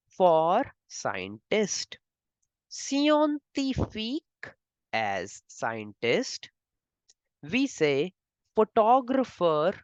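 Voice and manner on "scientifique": scientifique with French i sounds and a crisp k. Shown as a demonstration. Teacher models French scientifique. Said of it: "you pronounce it as".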